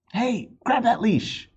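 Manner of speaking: high urgent shout; calling out. A narrator's reading. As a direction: yell for help with the leash. Someone shouting in the chase.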